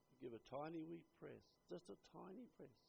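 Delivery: small, careful hush; gentle, hushed demonstration. Pretend. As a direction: say it delicately, dropping right down in volume. Acts out gently pressing the wax.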